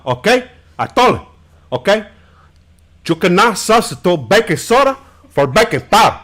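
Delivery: put-on Cuban-accented Scarface gangster voice; Scarface-style Cuban accent, angry and fast; Tony Montana impression. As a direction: play Tony Montana ranting about baking soda. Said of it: "When I do Tony Montana".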